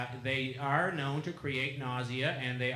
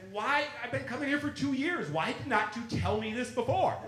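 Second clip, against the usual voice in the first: much higher, exasperated protest; indignant disbelief; 10 semitones higher than usual. Retold or imitated. Researcher protests to the couple who kept it secret, high and exasperated. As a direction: play up the outrage at being kept in the dark.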